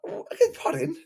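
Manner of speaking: high-pitched words through laughter; laughing disbelief. Real, not acted. Coach laughs at client's absurd rule. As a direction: laugh while asking what he means. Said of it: "I was laughing so much".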